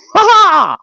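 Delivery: high, loud gloating ha ha; a shrill taunting laugh; mock cackle. Gloating at Uncle JJ's mistake, a high mock cackle.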